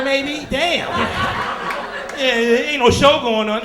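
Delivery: ordinary talk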